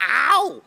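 put-on loud, high accented pain yell; Tom Dustin. Friend surfacing after a bad dive, imitated pained yell. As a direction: yelp "ow" in a comic accent, full volume. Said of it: "pain in an accent".

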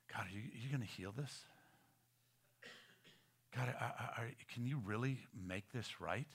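very soft, low, halting plea; quiet, hesitant imagined prayer; hushed doubting prayer. Acted. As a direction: ask God haltingly, almost to yourself. Acts a doubting believer praying.

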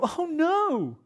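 high, exaggerated cry of dismay; mock dismay. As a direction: squeal it like a hammed-up disappointment. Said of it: "and we go".

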